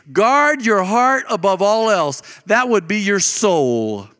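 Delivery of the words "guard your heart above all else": slow, weighty reading of the verse, leaning on Guard; solemn scripture reading. Acted. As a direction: let each word of "Guard your heart above all else" land slowly. Reads the verse slowly.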